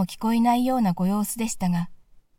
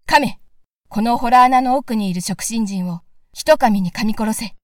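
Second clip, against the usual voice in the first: louder, bold order to the dog; brave command; 6 dB louder than usual. Acted. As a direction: command the dog fearlessly, loud. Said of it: "勇ましい声で言いつけました". (犬に勇ましく大声で命じる)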